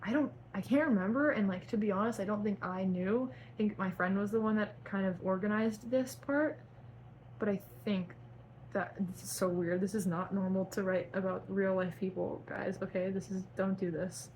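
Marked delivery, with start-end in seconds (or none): none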